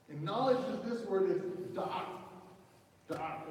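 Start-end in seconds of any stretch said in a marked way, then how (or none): 1.8-3.5 put-on DA'AT as the rabbi says it, in a Hebrew accent; imitated rabbi's Hebrew accent. Preacher showing how the rabbi would say it, imitated Hebrew accent. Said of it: "when the rabbi would say it".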